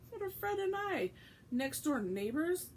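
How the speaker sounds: high, put-out protest; indignant. Acted. George protesting to his mother, indignant. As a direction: sound wounded at being overlooked. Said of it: "said George indignantly".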